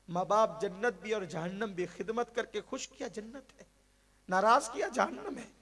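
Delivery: fiery pulpit warning; forceful preaching. Acted. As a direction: drive it home to the crowd. Preacher rising to full force.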